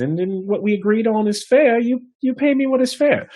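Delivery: half elf bard bargaining with the innkeeper, high voice; persuasive bard. Acted. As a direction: talk him into a trial night. Bard insisting on his stories.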